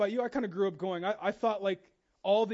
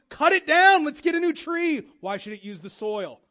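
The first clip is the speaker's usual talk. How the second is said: mock dismissive voice; 4 semitones higher, 7 dB louder than usual. Mocking a dismissive church member.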